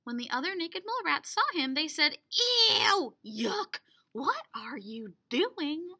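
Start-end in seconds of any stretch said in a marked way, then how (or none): 2.3-5.9 disgusted. Acted. Mole rats react in disgust.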